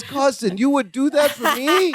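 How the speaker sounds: high, excited mock Balky voice; Balky impression. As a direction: squeak it out like touched Balky. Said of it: "Balky goes".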